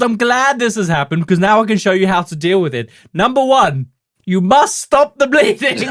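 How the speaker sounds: high, loud British TV cook; Julia Child parody. Acted. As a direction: instruct emphatically in a hammed-up high voice. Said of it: "I don't know why I'm British".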